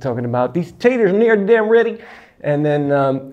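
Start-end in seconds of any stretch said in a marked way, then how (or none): 0.5-2.0 higher-pitched imitation of a Southern accent; imitated Southern US twang, pitched high; Southern American accent. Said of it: "talking in a Southern accent".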